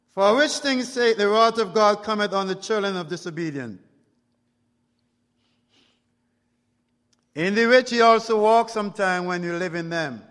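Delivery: loud preacher's proclamation; full-force scripture reading. Acted. Preacher warns the disobedient from scripture, declaiming. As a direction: declaim the verse to the whole church.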